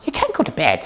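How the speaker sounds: normal talking voice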